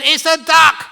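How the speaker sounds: high, very loud retort; heated shouting. Acted. Husband argues back at his wife, shouting high and heated. Acted quarrel, husband escalating.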